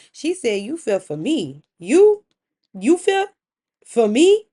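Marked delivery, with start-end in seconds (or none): 1.8-4.5 loud, high, incredulous questions in broken pieces; accusing. Acted. Wife answers husband's claim of fear, loudly accusing. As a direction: throw each piece back as a rising accusation. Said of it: "like, an accusation".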